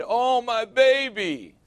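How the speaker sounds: high, slow cooing mom; doting mom. Retold or imitated. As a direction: coo over the fallen child like a fussing mother. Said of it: "they run over to the kid".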